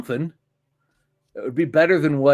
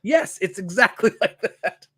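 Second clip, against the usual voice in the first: laughing; 5 semitones higher than usual. Real, not acted. Reacting to friend's accent joke.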